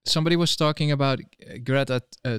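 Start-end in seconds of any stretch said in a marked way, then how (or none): none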